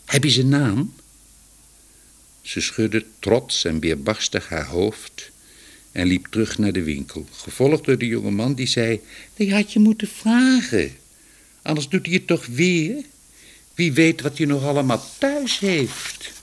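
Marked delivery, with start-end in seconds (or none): 0.0-0.9 put-on higher, pressing young man's question. A narrator's reading. Young man questions woman about the thief, pressing. 9.3-16.3 high-pitched, reproachful nagging; scolding. A narrator's reading. Young man follows woman into the shop, scolding.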